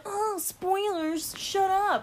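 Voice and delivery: high, slow mocking whine; mocking whiny voice. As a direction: mimic the spoiler-averse crowd, high and drawn out. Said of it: "People who are like".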